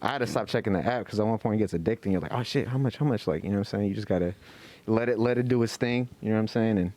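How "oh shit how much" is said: ordinary talk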